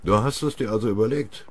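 ordinary delivery, nothing marked

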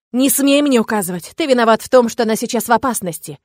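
high, very loud furious outburst. Acted. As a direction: bristle and lash out. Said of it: "Ощетинившись".

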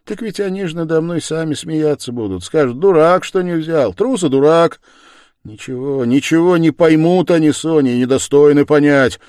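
high, loud, bitter argument; scornful protest. A narrator's reading. Man scorning those who would judge him, bitter. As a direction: build into contemptuous insistence.

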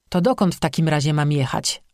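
ordinary delivery, nothing marked

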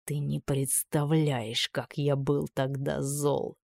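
put-on slow, low hiss of old anger; hissed through teeth. A narrator's reading. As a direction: spit it through clenched teeth. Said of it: "прошипел сквозь зубы".

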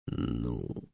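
very low, soft dragon grunt; a low rumbling prompt from the dragon; impatient dragon. A narrator's reading. (низкий нетерпеливый голос дракона)

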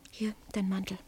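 soft unvoiced whisper handing over the coat. [name] handing tired Fred his coat, whispering. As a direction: hush it gently. Late night, tired friend.

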